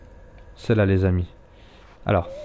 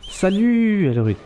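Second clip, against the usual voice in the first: cheery greeting; 10 semitones higher, 3 dB louder, slower than usual. Real, not acted. Greeting an NPC in game.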